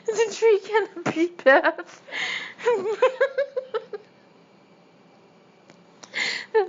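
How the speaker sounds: high, slow words squeezed through laughter; real giggling fit breaking up the narration; helpless laughter. Real, not acted. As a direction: struggle to read while cracking up. Helpless laughter while reading.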